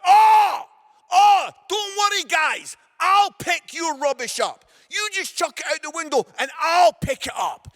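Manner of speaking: loud, high, mock-friendly yelling; sarcastic shout. Dad confronting litterers in the car park, loud sarcastic yell. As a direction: sarcasm at full volume. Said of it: "I was like".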